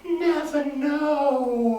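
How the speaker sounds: high, slow drawn-out croon; blind man's refrain. Acted. Blind man answers the hovering souls, high and drawn out. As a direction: float it up and stretch it out. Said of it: "He's like".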